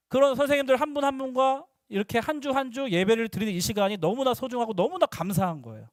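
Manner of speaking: ordinary talk, nothing marked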